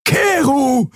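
high-pitched cry of a name; alarmed exclamation. A narrator's reading. Horrified onlooker calls to the attacker, exclaiming. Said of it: "rief Xea aus".

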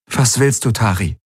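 kühle, abweisende Frage; kühl. A narrator's reading. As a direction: mit einem Hauch von Kälte fragen. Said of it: "fragte sie kühl". (kühl fragend)

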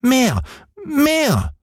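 put-on very high, demanding child's cry; Häwelmann crying out. A narrator's reading. Boy ordering the moon to shine, high childish cry. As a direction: demand it like an insatiable little boy. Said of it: "schrie der kleine Häwelmann".

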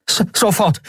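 high stammered reply; a flustered boy stammering his answer; startled boy. A narrator's reading. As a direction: trip over the first sound, then blurt it.

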